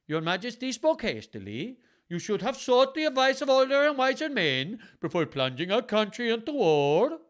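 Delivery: high, loud hammed-up Icelandic accent. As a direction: play the scheming earl in a silly Icelandic accent. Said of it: "taking my accents very seriously".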